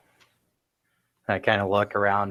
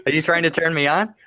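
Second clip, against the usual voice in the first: teasing flirt; 7 semitones higher, 5 dB louder, faster than usual. Acted. Teasing flirt after arm hit.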